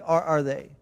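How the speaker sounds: ordinary talk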